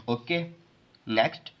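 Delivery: ordinary delivery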